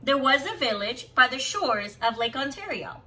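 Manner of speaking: lilting read-aloud storyteller voice; story narration. Acted. Teacher reading a picture book to students, lilting storybook voice.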